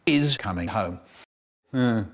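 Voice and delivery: ordinary talk, nothing marked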